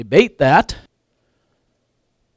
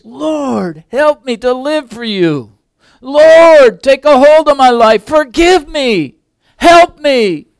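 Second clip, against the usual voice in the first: higher and louder with each cry of Lord, slow on forgive me; fervent pleading prayer; 11 semitones higher, 8 dB louder, slower than usual. Retold or imitated. Preacher reliving the boys' prayer, building from earnest to loud pleading.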